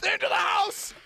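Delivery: high, loud panicked yelps with a laugh; real scared yelling. Real, not acted. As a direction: flee yelling in fright. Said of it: "I'm scared".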